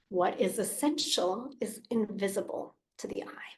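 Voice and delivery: soft, secretive whisper of the quote; hushed, reverent whisper. Acted.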